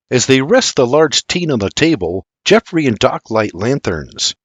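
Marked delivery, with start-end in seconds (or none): none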